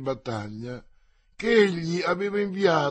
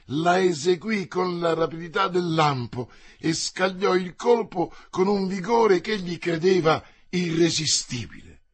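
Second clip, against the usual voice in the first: plain reading voice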